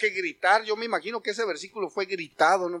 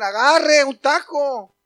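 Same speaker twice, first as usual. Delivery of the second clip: slow, louder, higher street-seller offer; taco vendor; 6 semitones higher, 8 dB louder, much slower than usual. Retold or imitated. Mexican vendor offering a taco, inviting. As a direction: tempt a passer-by with a taco.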